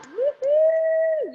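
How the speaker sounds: high, bright whoop of joy; happy cheer. Real, not acted. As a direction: cheer the finished combo. Cheering at end of run.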